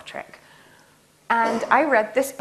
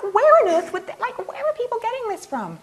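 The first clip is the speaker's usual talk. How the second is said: where on earth could they be; incredulous; 11 semitones higher than usual. Retold or imitated. Baffled by emails about brain-eating nanobots, high and incredulous. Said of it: "I'm like".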